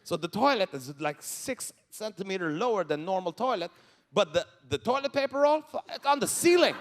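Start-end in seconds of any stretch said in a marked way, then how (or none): none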